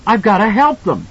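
high loud urgent cry; desperate cry. A narrator's reading. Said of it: "he cried".

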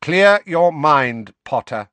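cold, slow command. Acted. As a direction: order it icily and slowly. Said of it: "said Snape's cold voice".

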